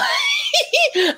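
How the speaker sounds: a squealing high laugh; real laugh at her own rant. Real, not acted.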